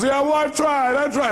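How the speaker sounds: high-pitched mock complaint; protesting caller. Caller protesting to her counselor, high mock complaint. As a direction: imitate a caller insisting she has made the effort. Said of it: "She goes".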